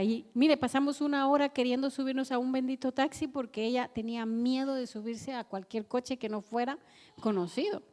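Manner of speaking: ordinary talk, nothing marked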